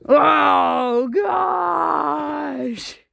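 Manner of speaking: half-crying mock shout of shock; surprised shout. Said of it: "Kevin Hack goes".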